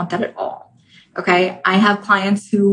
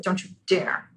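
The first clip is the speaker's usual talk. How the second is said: hushed warning; 2 semitones lower, 10 dB quieter than usual. Acted. Ego clinging to identity, hushed.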